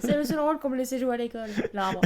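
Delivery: quick, laughing confession; amused, laugh still in the voice; through laughter. Real, not acted. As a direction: rattle it out while giggling. Still laughing at tree act.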